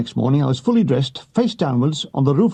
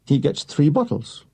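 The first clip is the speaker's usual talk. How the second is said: usual speaking voice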